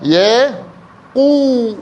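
"يقو" split into long ya, pause, high qoo; syllable by syllable. Shown as a demonstration. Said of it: "هاي شلون هاي؟". (نطق "يقو" مقطّعة وممدودة)

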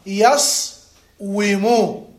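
يصوم slowly and firmly as yas... wu-mu; underlying form. Shown as a demonstration. Teacher showing each vowel of the origin, يصوم as slow yas-wu-mu. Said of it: "فتح، سكون، ضم، ضم".

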